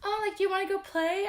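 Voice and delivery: put-on chirpy baby talk aimed at a child; sing-song kid talk. Shown as a demonstration. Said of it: "I never go like".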